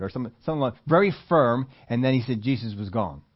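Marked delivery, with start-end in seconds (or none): none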